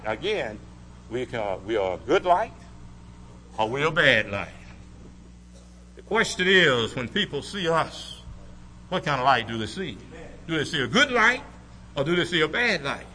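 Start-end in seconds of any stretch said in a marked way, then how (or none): none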